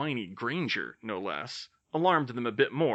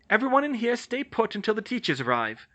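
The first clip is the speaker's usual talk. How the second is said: order; 10 semitones higher, 4 dB louder than usual. Acted. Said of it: "she ordered".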